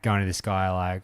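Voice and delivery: ordinary delivery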